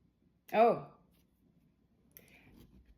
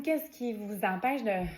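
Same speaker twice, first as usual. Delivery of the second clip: usual speaking voice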